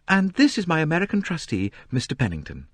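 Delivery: a hint of a gracious young heiress; light, polite young woman; young woman, lightly voiced. A narrator's reading. Lynette introduces trustee.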